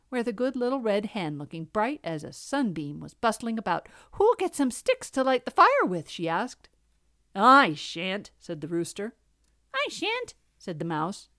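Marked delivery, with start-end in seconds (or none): 4.1-6.1 high, loud asking voice; bright little hen. A narrator's reading. Little red hen asking for sticks, bright. Cheerful hen asks for help. 7.3-8.3 grumbling rooster. A narrator's reading. Rooster refusing the hen, grumbling. 9.7-10.3 very high, small refusal; squeaky mouse. A narrator's reading. Mouse refusing the hen, high and squeaky.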